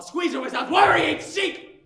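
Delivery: high, loud indignant jab; heated accusation. Acted. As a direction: hit the accusation hard. Hurling accusation at rival dog.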